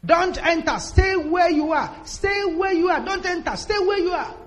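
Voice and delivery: high urgent warning shout; retold scream. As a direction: call out to stop a visitor at the gate. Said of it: "My friend screamed from where he was".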